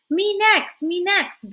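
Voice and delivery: high, loud eager shout. A narrator's reading. Bones shouting to confess next, loud and high. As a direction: yell to be picked first. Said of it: "Bones shouted".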